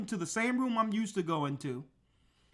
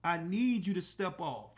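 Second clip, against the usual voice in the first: quiet, deliberate putdown; low-key firm warning, slowing at the end; firm and calm; 4 semitones lower, 6 dB quieter than usual. Retold or imitated. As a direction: lay down the law softly. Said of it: "And I told her just like that".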